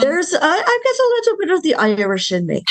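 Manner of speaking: put-on high, lilting Irish accent. Guest tells host of Irish roots, playful Irish accent. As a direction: slip playfully into an Irish brogue. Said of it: "I can't do the accent".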